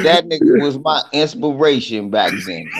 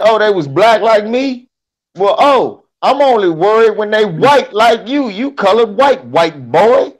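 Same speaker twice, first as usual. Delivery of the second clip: sarcastic comeback; 7 semitones higher, 5 dB louder than usual. Retold or imitated. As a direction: hit the comeback harder the second time. Repeated punchline, louder.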